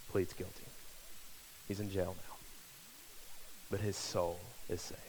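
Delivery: usual speaking voice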